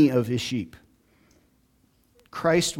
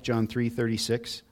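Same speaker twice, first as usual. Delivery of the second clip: ordinary talk, nothing marked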